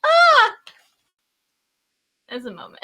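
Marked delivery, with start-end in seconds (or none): 0.1-0.6 short, high, loud shriek; re-enacted cry of shock; mock-alarmed yelp; startled scream. Acting out her reaction to baby.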